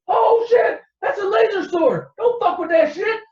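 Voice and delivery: high, loud panicked yelling; panicked shout. Retold or imitated. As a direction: scream in shock at the loss. Thug just lost his arm.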